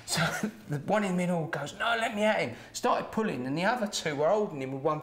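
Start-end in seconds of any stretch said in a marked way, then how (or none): 1.8-2.6 higher-pitched eager yell to get at someone; retold fighting bluster; mock aggressive. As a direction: act out a man straining against his mates to fight. Acting out drunk man straining to fight.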